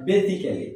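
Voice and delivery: "basically" said as "be-si-ke-li", four even syllables; common mispronunciation. Shown as a demonstration. Teacher showing learners how we wrongly say it, mispronounced "basically" as be-si-ke-li. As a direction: say "basically" with a full "ke" before "li".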